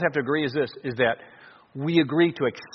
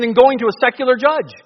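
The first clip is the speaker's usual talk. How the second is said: mildly sarcastic, raised pitch, louder towards the end; retold sarcasm; 7 semitones higher, 5 dB louder than usual. Preacher paraphrasing Paul's rebuke to the congregation, mildly sarcastic. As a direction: relay Paul's jab with a touch of sarcasm. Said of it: "he's using some sarcasm here".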